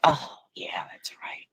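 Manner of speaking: hushed, barely voiced recall; whispered realization. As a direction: whisper it as if remembering in the night. Acting sudden recall at three a.m.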